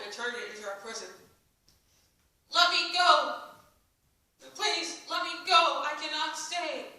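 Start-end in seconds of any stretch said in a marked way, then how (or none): 2.5-6.9 loud, desperate shout, slowing down; fearful shout. A narrator's reading. Ellie shouts in fear to be let out, loud and desperate. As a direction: beg to be let out, frightened. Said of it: "Ellie shouted in fear".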